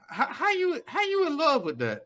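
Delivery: high, disbelieving how; can't believe it, pitch jumps up; incredulous. Real, not acted. Incredulous rant among friends.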